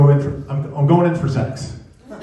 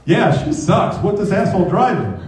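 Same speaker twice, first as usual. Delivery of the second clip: higher-pitched, indignant joining in; eager mock agreement; 7 semitones higher than usual. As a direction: jump in with hammed-up outrage. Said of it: "Ed two point oh jumps in".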